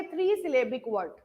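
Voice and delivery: ordinary talk, nothing marked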